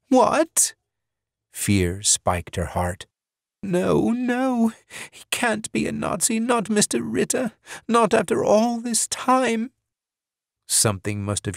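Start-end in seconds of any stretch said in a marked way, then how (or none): 0.1-0.7 high startled cry of disbelief; shocked woman. A narrator's reading. Sudden shocked question. 3.6-9.7 higher, pleading disbelief; frightened woman. A narrator's reading. Woman in denial.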